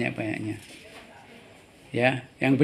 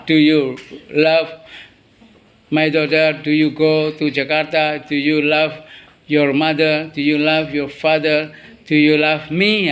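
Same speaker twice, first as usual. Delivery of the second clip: slow dictation; slower than usual. Shown as a demonstration. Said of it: "Kan sama".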